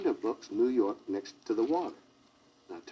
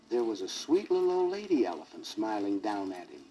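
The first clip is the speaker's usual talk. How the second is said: plain reading voice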